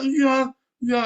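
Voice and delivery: high, offhand "iya, iya"; lightly voiced dismissive answer; acted reply. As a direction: brush it off in a put-on voice. Voicing reply in the story.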